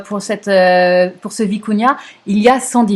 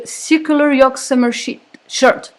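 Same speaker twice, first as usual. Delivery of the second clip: English title in a thick French accent; halting English, French accent; 4 semitones higher than usual. Real, not acted. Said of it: "mon accent anglais est terrible".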